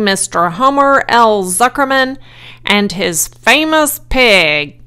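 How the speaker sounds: loud, booming pompous announcer; pompous loudspeaker. A narrator's reading. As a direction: announce it grandly to a big fair crowd. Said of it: "said the loudspeaker in a pompous voice".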